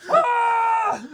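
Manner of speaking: a very high, very loud agonized cry; pained scream. Acted. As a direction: let out a howl of pain. Acted character screaming in pain.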